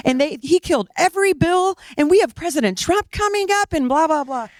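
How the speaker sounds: high, indignant rant trailing into blahs; incensed. Retold or imitated. Wife replaying her rant about killed bills, incensed and high. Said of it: "she's just incensed about all of it".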